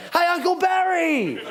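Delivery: very high, drawn-out holler; long high-pitched shout; drive-by yell. Acted. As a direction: call out to a passer-by from a car. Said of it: "I yell other stuff".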